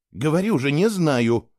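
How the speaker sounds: woman irritably repeating she doesn't know; irritated. Acted. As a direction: answer with annoyance. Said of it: "раздражённо ответила она".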